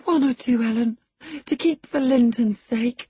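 earnest pleading. A narrator's reading. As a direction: beg to keep just a couple. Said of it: "She earnestly supplicated".

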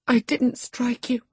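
protest, holding back another burst of emotion; upset protest. A narrator's reading. Young lady preventing another burst of emotion, upset muttering. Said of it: "muttered Cathy, chewing her lip".

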